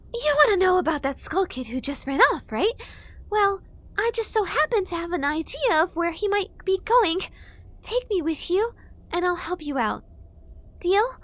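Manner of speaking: high, coaxing fairy; wheedling little fairy; fairy girl voice. A narrator's reading. Fairy bargaining.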